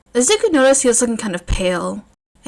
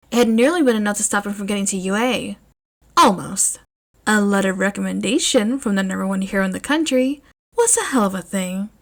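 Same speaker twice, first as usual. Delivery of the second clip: ordinary delivery, nothing marked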